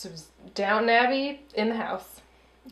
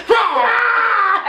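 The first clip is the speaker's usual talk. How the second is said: sudden loud mock shriek; mock scream; 11 semitones higher, 7 dB louder than usual. Friend retelling a movie scene, mock scream. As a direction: yelp like the fog attack. Mimicking a dinosaur alien attack.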